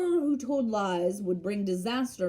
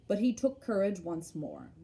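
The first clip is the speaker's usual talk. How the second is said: usual speaking voice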